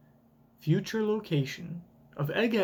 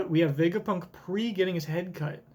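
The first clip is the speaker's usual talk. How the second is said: ordinary talk, nothing marked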